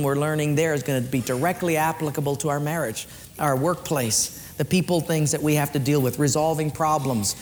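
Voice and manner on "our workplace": ordinary talk, nothing marked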